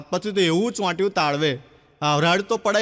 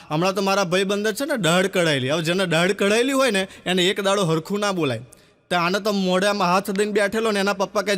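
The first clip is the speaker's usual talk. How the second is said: usual speaking voice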